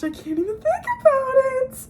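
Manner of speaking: real tears rising, pitch cracking up; near tears. Real, not acted. Telling viewers about the book's sad turn, close to crying. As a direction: voice breaking high, on the edge of crying. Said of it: "I'm gonna start crying right here".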